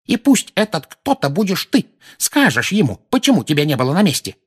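put-on high old woman's scolding; high-pitched furious demand; angry yelling. A narrator's reading. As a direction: order the culprit to own up. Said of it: "вопила миссис Фиг".

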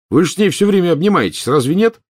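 high, loud baffled disbelief; can't believe what he's hearing; baffled protest. Acted.

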